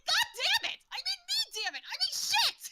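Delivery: high, loud frustrated shout; cursing yell. Acted. Goddess cursing herself after blurting a secret, loud frustrated yell. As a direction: explode at your own slip of the tongue. NPC curses at her slip.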